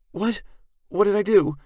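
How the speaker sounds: put-on high-pitched, loud alarmed girl voice; scared. A narrator's reading. Sarah hearing threats from behind the curtain, scared. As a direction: blurt it out high and frightened. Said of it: "She sounds scared".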